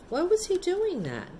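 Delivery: plain reading voice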